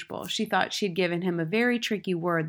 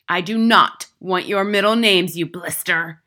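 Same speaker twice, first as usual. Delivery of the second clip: loud, emphatic headmistress; forceful, indignant shout; angry bellow; 10 dB louder than usual. A narrator's reading. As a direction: bellow the insult at the boy. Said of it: "the Trunchbull bellowed".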